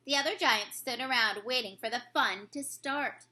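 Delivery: ordinary delivery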